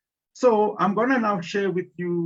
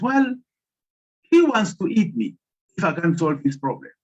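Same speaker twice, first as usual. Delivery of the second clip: higher and louder, urgent plea; woman telling the spirit she's in danger, urgent and higher; distressed woman; 4 semitones higher, 6 dB louder than usual. Acted. Woman explains her danger.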